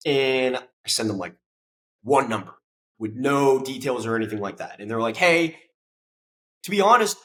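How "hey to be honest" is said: high, louder hedging voice; lightly voiced staffer hedging; mock polite campaign staff. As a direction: put on a polite client's voice.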